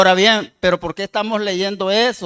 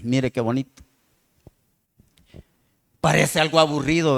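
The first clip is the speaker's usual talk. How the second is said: normal talking voice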